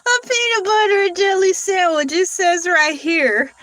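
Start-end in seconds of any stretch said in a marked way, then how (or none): 0.1-2.1 reading through real laughter; laughing at the absurd answer; giggling while reading it out. Real, not acted.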